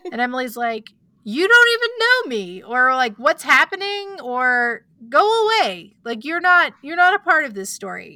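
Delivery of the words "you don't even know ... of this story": higher, louder, heated telling-off; angry brush-off. Retold or imitated. Emily tells Krista to go away, angry and louder. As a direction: push the intruder away, fed up.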